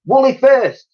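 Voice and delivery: high loud command; shout. Acted. Girl ordering mammoth up the ramp, shout. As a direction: call it over the commotion. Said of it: "shouted Elsie".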